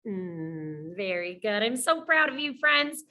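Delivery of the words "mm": "Mm" as a soft held nasal hum, like the end of win; sustained, low "Mm" with no vowel; isolated final sound. Shown as a demonstration. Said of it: "the last sound you hear in win".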